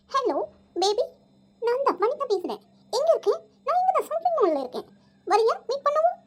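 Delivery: affected stylish talk. Acted. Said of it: "நான் கொஞ்சம் ஸ்டைலா பேசினா". (ஸ்டைலா இழுத்து, உயர்ந்த குரலில் பேசுவது)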